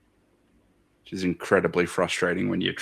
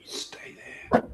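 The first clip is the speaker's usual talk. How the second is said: whispering; 7 dB quieter than usual. Real, not acted. Whispered to object.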